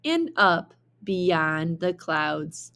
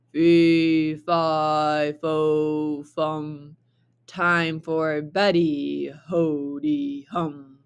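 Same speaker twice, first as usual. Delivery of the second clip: booming giant; 3 semitones lower, much slower than usual. Acted. Giant sings out from inside the castle, low and booming. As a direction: boom it out like a sleepy giant. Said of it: "a voice boomed".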